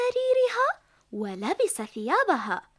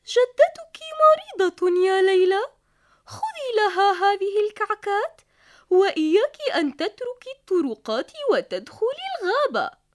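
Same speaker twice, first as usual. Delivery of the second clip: mother's voice; 3 semitones higher, 6 dB louder than usual. Acted. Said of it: "قالت الأم".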